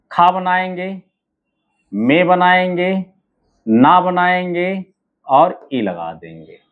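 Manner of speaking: ordinary talk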